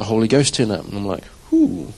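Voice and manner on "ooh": excited ooh. Retold or imitated. Excited as the Holy Ghost arrives.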